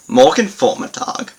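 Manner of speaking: Morgen Vormittag said high and loud, clearly marked; teacher isolates Morgen Vormittag; German phrase demo. Shown as a demonstration.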